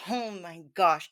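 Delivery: ordinary delivery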